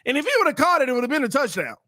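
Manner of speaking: high-pitched frustrated replay of the play; exasperated rant. Real, not acted. Commentator rants about the missed touchdown, exasperated. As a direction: complain it was there for the taking.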